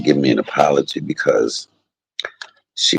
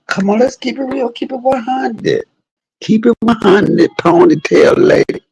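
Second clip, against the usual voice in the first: much higher, slow, growing louder; high taunting voice; 4 dB louder than usual. Retold or imitated. Taunting the ponytail lady to keep it real. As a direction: taunt her in a sing-song. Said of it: "Apologize for what lies you tell".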